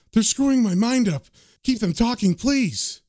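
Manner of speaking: acted panic of a man under attack; desperate plea. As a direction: plead as if losing control. Struggling medium begs for help.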